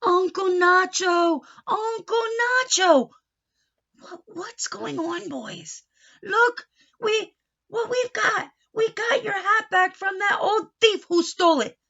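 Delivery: loud and high, slow calls then eager; excited calling. Acted. Boys call out to Uncle Nacho, excited. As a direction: call him, bursting with news.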